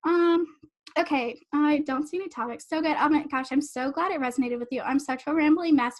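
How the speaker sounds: usual speaking voice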